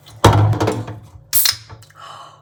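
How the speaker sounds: loud breathy gasp of delight; unvoiced excited gasp. Real, not acted. Excited gasp at opening the fresh baguette. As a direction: gasp at the treat.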